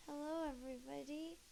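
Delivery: ordinary delivery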